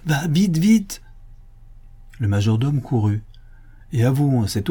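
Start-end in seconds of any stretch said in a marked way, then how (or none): 0.1-1.0 high, mounting urgency; urgent. Acted. Master sends majordomo running to the bell, urgently. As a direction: hurry them off, rising on the last word.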